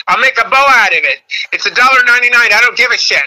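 high, loud-ish imitated mom rant; feisty mom. Comic retells mom defending a bargain blouse, feisty mom voice.

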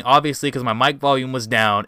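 ordinary talk, nothing marked

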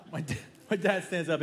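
normal talking voice

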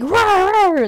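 high little mock roar; cute monster roar. Imitating monster talk.

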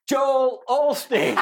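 high, slow reveal breaking into laughter; laughing punchline. Retold or imitated. Retelling who she said he looks like, laughing reveal. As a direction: draw out the name, about to crack up.

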